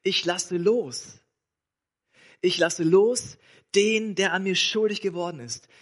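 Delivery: very slow, each word weighed; "ich lasse los" spoken very slowly, stressing "los"; slow emphatic. Shown as a demonstration. Preacher explains forgiving means letting go, very slow. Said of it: "Vergeben heißt jetzt".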